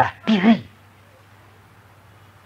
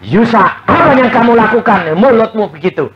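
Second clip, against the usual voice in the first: loud, higher stern rebuke; voice of God rebuking; 4 semitones higher, 7 dB louder than usual. Retold or imitated. God rebuking Yusa about his words, loud and stern. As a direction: call him out by name and scold. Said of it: "Tuhan bicara sama saya".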